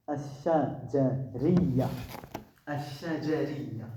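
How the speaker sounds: "al-shajariya" drawn out syllable by syllable; slow dictation. Shown as a demonstration. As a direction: stretch "al-shajariya" slowly for learners to copy. Teacher dictates a new term. (dictée lente, syllabe par syllabe)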